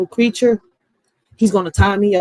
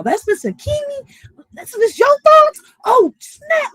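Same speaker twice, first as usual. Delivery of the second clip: very high, rising astonished squeal; high squeaky disbelief; mock shock. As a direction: act totally floored. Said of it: "I'm like".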